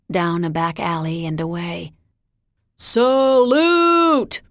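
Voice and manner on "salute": put-on loud, high drunken cheer; ripping yell. A narrator's reading. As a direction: rip the cheer through the air. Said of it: "Bud's voice ripped through the air".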